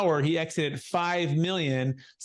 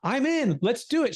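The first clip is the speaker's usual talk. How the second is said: higher-pitched imitation of an eager wife; eager agreement; 8 semitones higher than usual. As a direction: jump in keenly, voicing the wife.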